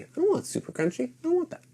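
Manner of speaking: high, soft whiny complaint; whiny refusal. Retold or imitated. Picky kid refusing crunchy peanut butter, high and whiny.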